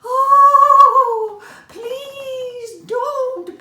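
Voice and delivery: high, loud wailing plea; begging mouse. A narrator's reading. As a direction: cry out like a terrified tiny mouse. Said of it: "begged Fuzzy Tail again".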